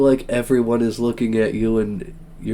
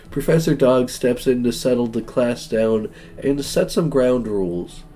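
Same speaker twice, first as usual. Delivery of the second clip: normal talking voice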